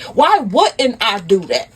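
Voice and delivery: slow, pointed comeback; acted indignant retort. Wife answering her cheating husband, pointedly indignant. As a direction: throw the question back, drawn out. Wife throws the question back.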